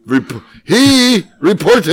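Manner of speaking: high, loud, slow olde-English proclamation; pompous archaic declaiming, high and slow; mock archaic reading. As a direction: ham up the old text like a town crier. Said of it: "reading old text mockingly".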